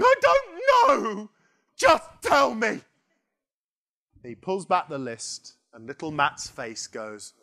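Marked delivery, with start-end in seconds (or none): 0.0-2.8 frantic plea to stop the suspense; exasperated shout. Retold or imitated. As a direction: cry out desperate to know the answer. Impatient player begging to know.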